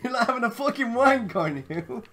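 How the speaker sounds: high, loud laughing jeer. Real, not acted. Laughing at teammate.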